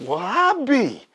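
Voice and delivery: put-on high old-geezer exclamation; astonished old man. Old neighbor amazed at the boy's ducks, high exclamation. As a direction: pitch it up in amazed disbelief. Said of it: "the old man's like".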